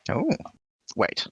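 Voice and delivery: ordinary talk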